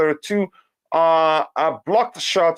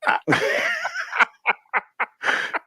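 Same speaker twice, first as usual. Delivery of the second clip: laughing; 3 dB quieter than usual. Real, not acted. Host laughs at own verdict.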